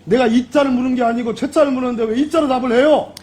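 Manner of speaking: heated shout across the room; shouting match. Real, not acted. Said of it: "여기 소리 지르는 데가 아니지 않습니까?".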